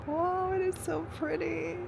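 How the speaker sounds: high, excited wow; awed delight. Real, not acted. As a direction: swoon over the view. Admiring the view.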